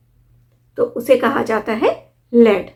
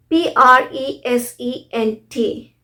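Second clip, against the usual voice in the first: ordinary talk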